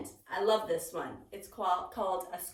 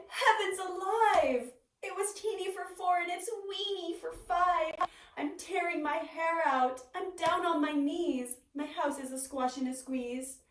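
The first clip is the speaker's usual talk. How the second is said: put-on higher, fretful old lady voice; distressed old lady; 7 semitones higher than usual. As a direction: wail about the tiny house.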